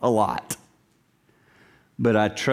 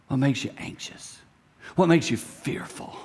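hushed question; 5 dB quieter than usual. Acted. Asking kids their fears softly.

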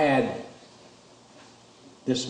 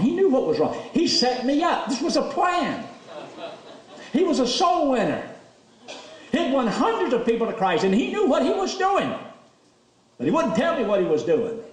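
much higher, laughing excitement; laughing delight; 12 semitones higher than usual. Real, not acted. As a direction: marvel at the trick, laughing. Delighted recalling father-in-law's plan.

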